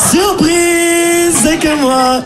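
high, stretched-out "surprise", then dropping; mock reveal. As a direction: announce yourself with hammed-up fanfare. Mock reveal to the mistaken bookseller.

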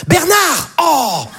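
put-on high shout across the square after her child; mother shouting after her runaway four-year-old. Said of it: "et qui est là genre".

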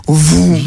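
long "vroom" of acceleration; mouth-made speeding noise; imitated engine whoosh. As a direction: voice a car zooming away. Imitates car accelerating again.

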